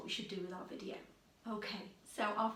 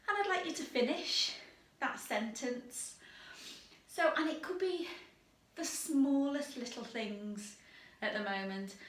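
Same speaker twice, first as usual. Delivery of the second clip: ordinary talk, nothing marked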